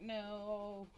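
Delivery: high, drawn-out complaint; mock dismay. Telling viewers about washing after a holiday, mock dismay. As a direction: whine it in mild mock dismay.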